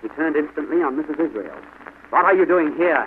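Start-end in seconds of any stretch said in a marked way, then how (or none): 2.1-3.0 loud, higher rebuke; demanding cry. A narrator's reading. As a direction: turn on her sharply. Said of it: "he cried".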